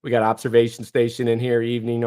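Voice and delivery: usual speaking voice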